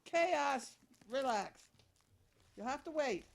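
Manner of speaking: loud, high call to the dogs. Real, not acted. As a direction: call out firmly over the racket. Calling out to excited dogs.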